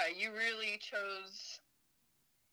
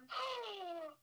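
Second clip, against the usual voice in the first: sharp gasp of sudden realisation; surprised gasp; 10 semitones higher, 5 dB quieter than usual. Real, not acted. Spotting that the birthday is tomorrow, surprised gasp. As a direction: gasp at a discovery.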